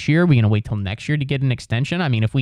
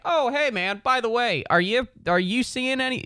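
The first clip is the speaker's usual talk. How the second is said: high, soft sing-song buddy voice; mocking a gossipy friend; mock chatty friend; 10 semitones higher, 5 dB quieter than usual. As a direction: ask like a nosy pal, pitched way up. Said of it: "I'm not gonna go out of my way to be like".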